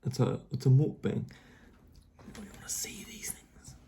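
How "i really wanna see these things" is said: hushed eager whisper. Real, not acted. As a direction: whisper it close to the mic. Excited whisper to camera.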